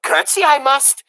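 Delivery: high, loud declaration; loud eager girl; shouted. A narrator's reading. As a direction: blurt it out nervously. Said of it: "shouted Mig".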